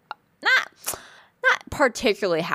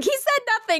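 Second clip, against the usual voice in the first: much higher, mock despair; high-pitched disappointed voice. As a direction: squeak it out in dismay. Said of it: "I was like".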